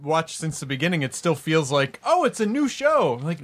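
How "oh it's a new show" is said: higher-pitched, wide-swinging mock surprise; mock surprised viewer. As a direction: imitate a viewer discovering something new. Voicing a viewer's reaction.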